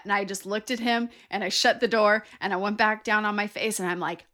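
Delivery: normal talking voice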